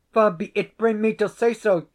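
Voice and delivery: high, strained denial; anxious denial. A narrator's reading. Stephen nervously denies accusing the laird, high and tense. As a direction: pitch jumps up in alarm.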